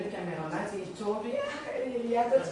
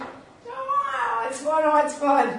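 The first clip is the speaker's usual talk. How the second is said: high, louder sarcastic glee; mock cheer; 6 semitones higher, 5 dB louder, slower than usual. Teacher joking about her fun class, high. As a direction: sing it out in fake delight.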